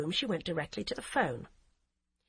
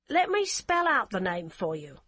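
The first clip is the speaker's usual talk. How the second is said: put-on heavy German accent; 4 dB louder than usual. Hamburg policewoman answering the phone in a curt, heavy German accent. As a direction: clip it like a stiff German officer. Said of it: "she said helpfully".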